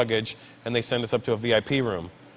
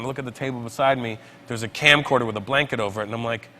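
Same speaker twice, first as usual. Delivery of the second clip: normal talking voice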